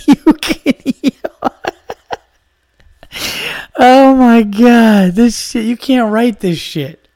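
high, slow, loud words through laughter; laughing fit. Real, not acted. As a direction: gasp it out mid-laugh. Laughing fit after punchline.

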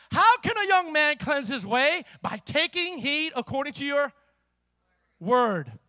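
ringing scripture recitation; declaimed scripture. Real, not acted. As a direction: proclaim the verse to the room. Reads scripture aloud to congregation.

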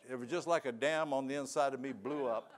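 ordinary talk

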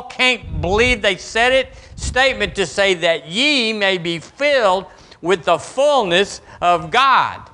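ordinary delivery

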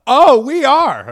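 squeaky loud eager reply; eager dwarf. Acted. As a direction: overeager high little man. Eager dwarf voiced high, loud.

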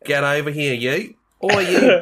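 loud, hammed-up Aussie hard man; mock tough Australian. Action hero threatens a villain, loud mock Australian. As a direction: act hard in an Australian accent. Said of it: "he's trying to be hard".

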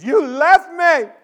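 high, loud, drawn-out wail; wailing child's accusation. Retold or imitated. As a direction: cry it out at full volume like a hurt kid. Child repeats accusation, louder.

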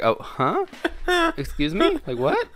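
baffled reaction. Acted. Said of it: "that moment where you're just like".

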